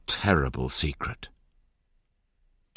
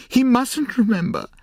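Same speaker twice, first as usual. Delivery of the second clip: high, loud anguished "He mustn't remember", much higher; crying out; 6 dB louder than usual. A narrator's reading. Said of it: "she cried".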